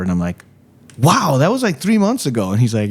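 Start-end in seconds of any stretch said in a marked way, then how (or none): 1.0-2.5 high, mildly mock amazement; retold astonishment. Comedian replaying his answer to the long-delayed caller, astonished. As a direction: re-enact being taken aback. Retold reply to a very late call.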